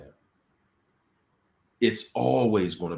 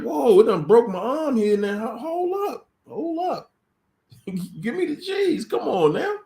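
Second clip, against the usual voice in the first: high, loud, laughing plea for the cheese; mock victim pleading; 13 semitones higher, 4 dB louder than usual. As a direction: beg like a trapped rat, way higher. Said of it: "And you like".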